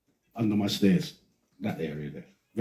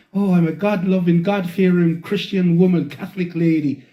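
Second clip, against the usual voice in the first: higher, pious Irish voice; put-on Irish accent, professing piety; Irish lady impression; 5 semitones higher, 4 dB louder than usual. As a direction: imitate a devout Irish woman. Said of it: "come out and say".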